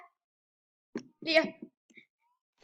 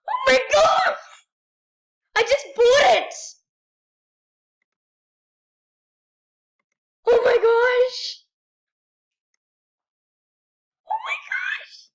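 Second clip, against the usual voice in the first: high, loud screams of joy, almost crying; ecstatic screaming; 9 semitones higher, 11 dB louder than usual. Real, not acted. Finally buying the ribbon heels, ecstatic high screams. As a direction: scream with delight like a dream come true.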